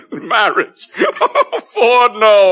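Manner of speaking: mocking laughter; high, laughing disbelief. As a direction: laugh at him in scorn. Mocking the Savage's poem.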